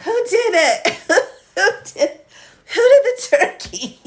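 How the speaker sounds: high squeaky giggling question; words squeezed through laughter; laughing while talking. Real, not acted. Laughing fit at turkey image.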